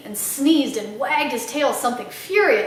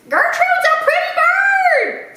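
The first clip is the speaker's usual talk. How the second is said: high, loud, slow squawking parrot; parrot scream; 6 dB louder, slower than usual. Acted. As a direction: screech it out like a proud parrot. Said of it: "screamed Gertrude".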